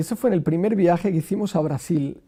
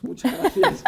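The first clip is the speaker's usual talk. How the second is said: high, lilting polite thanks; a playful high brush-off; sing-song thanks. Retold or imitated. The Pope kindly declining the rosario, high and lilting.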